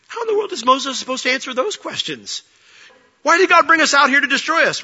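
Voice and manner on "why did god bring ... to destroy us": incredulous. Acted. Preacher voicing Moses' exasperation.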